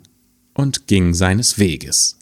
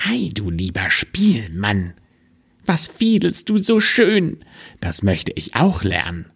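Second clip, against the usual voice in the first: sly fox voice; 3 semitones higher than usual. Acted. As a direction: flatter the fiddler as a curious fox. Fox speaks to the fiddler.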